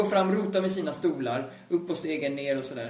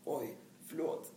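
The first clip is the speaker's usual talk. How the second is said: soft, slow, much quieter apology; sheepish apology; 3 semitones lower, 13 dB quieter, slower than usual. Retold or imitated. Caught knocking at the window, a soft sheepish sorry. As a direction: shrink back and apologise under your breath. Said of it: "Och jag står där och bara".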